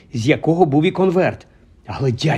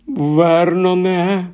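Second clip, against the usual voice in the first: higher, slow trembling call; 4 semitones higher, much slower than usual. Acted. Aunt calling her husband with trembling voice. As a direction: say his name, voice shaking. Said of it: "тремтячим голосом".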